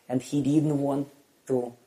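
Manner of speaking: ordinary talk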